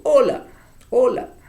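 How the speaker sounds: hola called out high and loud as a greeting; exclaimed greeting. Shown as a demonstration. Teacher showing hola as an exclaimed greeting. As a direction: shout hola like greeting a friend. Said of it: "si yo digo".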